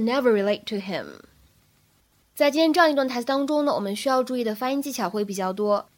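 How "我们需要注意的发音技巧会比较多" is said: usual speaking voice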